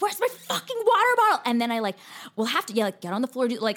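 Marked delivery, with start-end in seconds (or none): 0.0-1.4 high, loud mock scream kept off full force; held-back scream. Losing it over the missing water bottle, mock-screaming. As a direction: yell it but spare the mic. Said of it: "I don't wanna scream in the mic".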